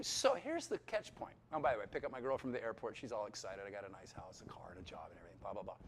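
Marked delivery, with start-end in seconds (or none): none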